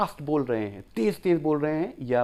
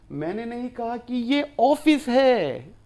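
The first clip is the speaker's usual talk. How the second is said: Office raised high with a rising tune, whole line slower; teacher stressing office, said higher; 9 semitones higher, 4 dB louder, slower than usual. Shown as a demonstration. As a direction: lift office up, as if it were a classroom. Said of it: "ये तो classroom है।".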